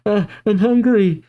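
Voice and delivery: put-on small child. A narrator's reading. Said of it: "her child saying".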